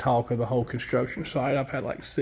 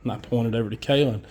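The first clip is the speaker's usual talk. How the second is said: ordinary talk, nothing marked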